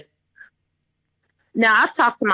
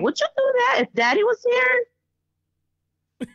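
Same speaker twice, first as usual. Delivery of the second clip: high, slow mock challenge; challenging mother; 13 semitones higher, slower than usual. Mother to spoiled son after door slam, high challenge. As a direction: draw it out high, testing the child.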